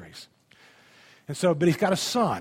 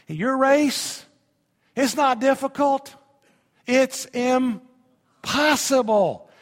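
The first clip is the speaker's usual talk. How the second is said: high, loud, fervent preaching voice; 7 semitones higher, 4 dB louder than usual. Acted. Pastor to congregation, rousing full-force preaching. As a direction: preach it up to the rafters.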